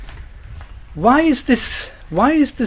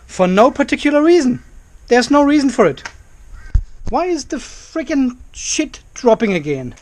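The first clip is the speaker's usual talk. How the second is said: frustrated outburst; 7 semitones higher than usual. Real, not acted. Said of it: "I'm frustrated".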